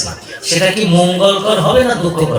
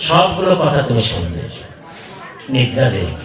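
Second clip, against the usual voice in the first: much lower, grave instruction; solemn Shiva; 4 semitones lower, 4 dB quieter than usual. Acted. Shiva gravely warns Parvati not to fall asleep, much lower. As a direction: warn her not to sleep.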